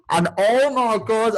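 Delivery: loud, high, drawn-out exasperation; retold anger. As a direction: relive the outrage loudly and slowly. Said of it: "I've never been so angry".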